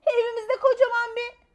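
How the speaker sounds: put-on high, loud breathless child voice; alarmed child. A narrator's reading. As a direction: blurt it out in a panic. Child reports monster in alarm. (telaşla anlatan küçük kız)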